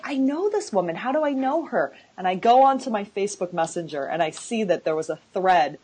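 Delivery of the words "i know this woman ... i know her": high-pitched puzzled recognition; retold astonishment. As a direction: relive the surprise of recognizing someone, voice raised high. Retells recognizing the author's name.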